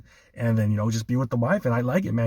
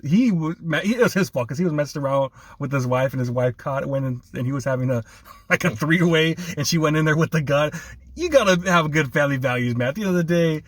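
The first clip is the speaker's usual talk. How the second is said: ordinary talk, nothing marked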